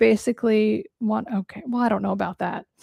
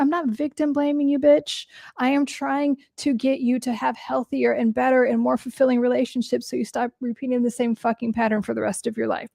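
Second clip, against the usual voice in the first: normal talking voice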